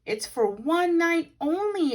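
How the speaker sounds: exclaiming. A narrator's reading. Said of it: "exclaimed Paddington".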